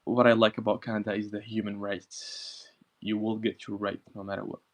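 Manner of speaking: usual speaking voice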